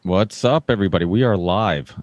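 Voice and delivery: ordinary talk, nothing marked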